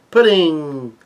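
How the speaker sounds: drawn-out swooning cry, high and falling; adoring call. Acted. A crowd with hearts on their eyes, adoring. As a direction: call her name like a smitten fan. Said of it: "people have hearts on their eyes".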